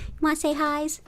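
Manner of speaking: high cooing voice to a cat; pet talk. Real, not acted. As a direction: coo it to the kitty. Talking to the cat.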